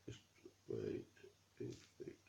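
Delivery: breathy whispered wait, wait under the breath. Real, not acted. Vlogger adjusting the doll's dress, whispering to herself. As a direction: whisper it while fiddling.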